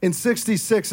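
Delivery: ordinary talk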